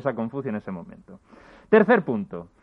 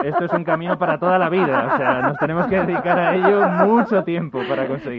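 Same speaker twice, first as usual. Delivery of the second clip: talking through laughter; laughing while explaining; 4 semitones higher, 8 dB louder than usual. Real, not acted. Risa sobre la pareja. (hablando entre risas)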